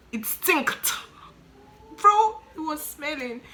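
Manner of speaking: high, loud, drawn-out disgust; appalled emphasis. Retold or imitated. Telling how the mortuary smelled, appalled. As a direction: stretch it out, appalled by the stench. Reliving the stench.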